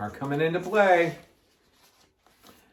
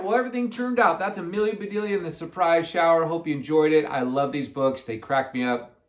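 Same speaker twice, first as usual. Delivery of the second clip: ordinary delivery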